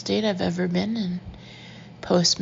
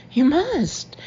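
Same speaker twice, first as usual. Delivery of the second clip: higher and louder, accusing; insistent accusation; 8 semitones higher, 5 dB louder than usual. A narrator's reading. Woman confronting her evasive boyfriend, high and insistent. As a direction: pin him down on it.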